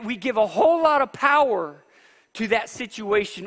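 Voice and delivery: rising revival preaching; fiery preaching. Acted. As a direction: preach it hard to the crowd. Preaching climax.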